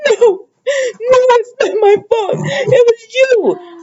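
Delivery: imitating Steffi in tears, slow and high; upset crying. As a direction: whimper the denial like a wounded daughter. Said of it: "Steffi gonna get all upset".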